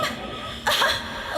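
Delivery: short high breathy scoff of a laugh; scornful laugh. Acted. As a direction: sneer it out with a laugh. Mushroom lord's scornful laugh.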